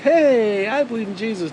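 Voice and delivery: hammed-up chummy greeting; mock friendliness. Mocking a believer cosying up to a nonbeliever, mock friendliness. Mocks believers getting along.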